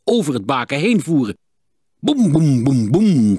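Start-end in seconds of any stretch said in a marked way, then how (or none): 2.0-3.4 luid, galmend boem-geluid met de stem; nagebootste dreunen. A narrator's reading. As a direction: bons het uit als slagen op een bodem. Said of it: "klonk het tegen de bodem". (luide dreunende klanknabootsing)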